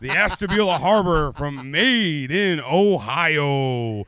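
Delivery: loud hype intro; announcer intro. Acted. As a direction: sell the location. Stream opener, louder intro.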